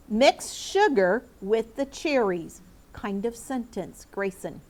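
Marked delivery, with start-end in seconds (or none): 0.1-2.6 slow dictation. Shown as a demonstration. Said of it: "Listen to this sentence".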